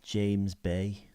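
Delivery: put-on short try at a Liverpool accent. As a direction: attempt the name in mock Scouse. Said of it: "I can't say that as [name]".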